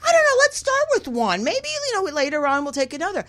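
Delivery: high, bright-pitched quote of herself; retold casual suggestion. Talking a friend into starting small, high-pitched retold self. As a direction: pitch it way up, then rush the end. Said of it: "I'm like".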